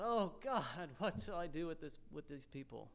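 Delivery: soft, sinking, helpless plea; imagined crying out. Acted. Moses imagined praying about his people, softly crying out. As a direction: appeal to God quietly, fading away at the end. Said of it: "You just imagine him crying out".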